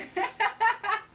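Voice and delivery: high, loud peal of laughter; laughing. Real, not acted. Amused by jealous moderators.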